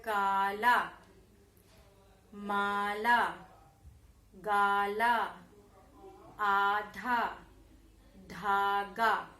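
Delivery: slow, clear teacher drill with pauses; repeat-after-me word list. Shown as a demonstration. Teacher to young pupils, reading out words to repeat. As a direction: say each word for children to echo. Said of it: "जैसे कि".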